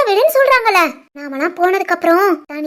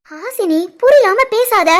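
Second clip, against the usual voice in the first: ordinary talk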